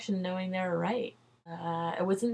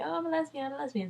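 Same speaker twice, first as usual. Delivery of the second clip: higher, softer mock sing-song voice; a coworker's joking higher voice; joking mock claim; 8 semitones higher, 6 dB quieter, much faster than usual. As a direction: toss it off as a playful tease. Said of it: "jokingly, I guess, say".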